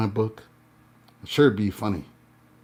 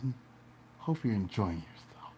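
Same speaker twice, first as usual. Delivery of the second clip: whisper; 3 semitones lower, 9 dB quieter than usual. Pretend. Teasing an imagined hater.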